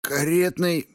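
high-pitched, pained lisp; lisping injured thug. A narrator's reading. Beaten thug gives up an address, lisping. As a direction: answer through a smashed mouth.

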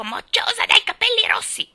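shrill, high squawking parrot voice; parrot's screech. A narrator's reading. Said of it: "continuò a intervalli a lanciare il suo". (strillo di pappagallo acuto e forte)

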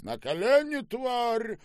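high, loud yell; furious command. Acted. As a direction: force obedience with a shout. Штаубе violently orders her down. (яростный окрик)

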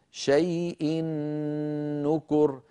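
"شيء نكر" joined by a long doubled humming n; tanween into noon. Shown as a demonstration. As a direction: stretch the nasal n before "نكر". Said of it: "فصار النطق بنون مشددة".

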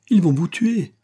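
high, urgent exclamation; alarmed cry. A narrator's reading. Aurora warning the young man, high alarmed cry. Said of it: "s'écria-t-elle".

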